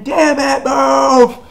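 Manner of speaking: high, loud hammed-up yell; exasperated mock shout. Alan yells at Donna to move, high mock shout. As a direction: bellow it as an urgent order. Retelling a character's urgent shouted order.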